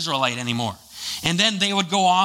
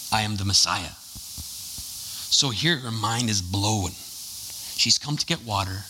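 usual speaking voice

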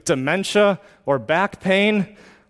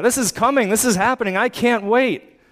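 higher, excited can't-wait voice; eager believer; 7 semitones higher than usual. Pretend. Believer telling people hope is coming, excited and higher. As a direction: bubble over telling a friend what's coming.

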